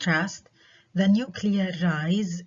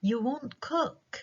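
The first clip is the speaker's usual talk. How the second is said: high, slow "You won't cook", pitched as a question; statement as question; 7 semitones higher, 4 dB quieter, slower than usual. Shown as a demonstration. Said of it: "النغمة بتخبرني إنه هذا سؤال".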